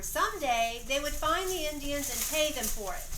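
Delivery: ordinary delivery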